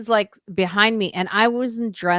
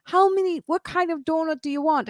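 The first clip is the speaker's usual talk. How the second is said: much higher put-on voice; imitating Lois; 9 semitones higher than usual. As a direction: squeak the question like the fussy friend. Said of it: "She goes".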